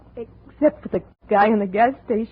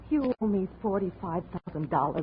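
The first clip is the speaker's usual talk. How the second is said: usual speaking voice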